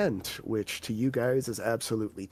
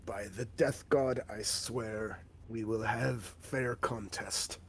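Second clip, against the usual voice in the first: solemn, a touch slower orc oath; lightly voiced orc warrior; 3 semitones lower, 6 dB quieter than usual. Orc warrior swearing a fair contest to the party, lightly voiced.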